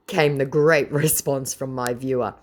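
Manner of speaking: ordinary talk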